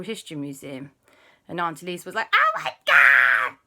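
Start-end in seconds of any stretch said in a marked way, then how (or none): 2.3-3.6 loud, slow, hammed-up squawk; overexcited cry. Auntie greets arriving family, overexcited loud cry. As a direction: wail it in overblown excitement.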